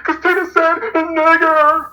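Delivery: high, drawn-out fake sob; mock crying. Mocking those who cry over Tina's word, fake crying. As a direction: wail it slowly in hammed-up tears. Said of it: "And cry".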